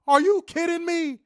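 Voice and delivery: loud, high outraged yell. Real, not acted. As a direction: shout your disbelief at them. Outraged at the broadcast.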